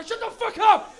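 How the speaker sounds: high, loud angry yell; furious shout. Retold or imitated. Couple in the front seat yelling at him, loud. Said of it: "And they were".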